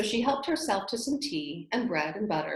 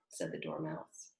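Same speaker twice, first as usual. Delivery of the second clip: ordinary delivery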